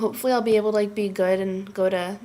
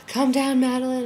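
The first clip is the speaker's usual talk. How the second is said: quoted video line; 3 semitones higher than usual. Retold or imitated. Quoting a friend's funny video.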